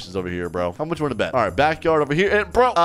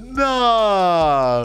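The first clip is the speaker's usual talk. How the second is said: high, drawn-out protest; exaggerated sore-loser groan; mock dismay; 7 semitones higher, 4 dB louder than usual. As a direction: wail it in mock disbelief. Acting out found player's dismay.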